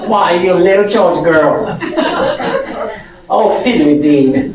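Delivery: a light mock Southern belle accent. Teacher teasing the class in a light mock Southern belle accent. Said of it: "Live in the South for a while".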